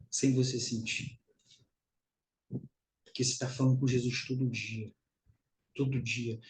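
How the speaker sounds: hushed words laid gently on the congregation; soft intimate preaching. Acted. Preacher confiding softly to his congregation, hushed and tender.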